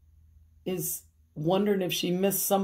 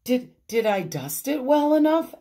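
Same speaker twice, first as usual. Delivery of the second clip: higher, louder questioning voice; slight character voice, pitched up; lightly voiced Amelia; 7 semitones higher, 6 dB louder than usual. A narrator's reading. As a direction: lightly play an eager housemaid asking. Amelia's anxious-to-please question in story.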